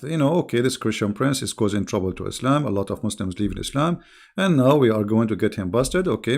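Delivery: normal talking voice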